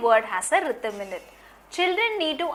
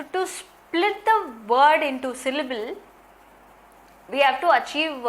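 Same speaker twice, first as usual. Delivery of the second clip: usual speaking voice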